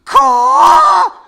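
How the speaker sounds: huge, very high shrieking caw; outraged caw. Pretend. Crow finding his car dinged, outraged shrieking caw. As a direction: scream the caw, furious and asking. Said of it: "exclamation point, question mark".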